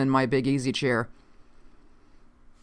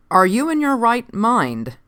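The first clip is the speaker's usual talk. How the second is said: slow, higher-pitched incredulous question; 7 semitones higher, 4 dB louder, slower than usual. A narrator's reading. Officer doubting the stranger's sanity, asked slowly. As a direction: draw it out, pitched up. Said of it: "I asked slowly".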